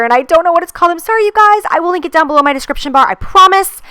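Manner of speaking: high, bright-eyed sorry voice, fast in the middle; squeaky apology. Real, not acted. Apologising to viewers for the unnamed glitter, squeaky and high. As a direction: pitch up sheepishly to apologise.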